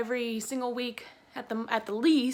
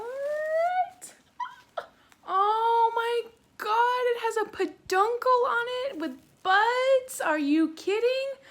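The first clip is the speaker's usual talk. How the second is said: much higher, louder, gushing with delight; excited squeal; 11 semitones higher, 4 dB louder, slower than usual. Real, not acted. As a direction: squeal over the new buds. Discovers buds on new plant.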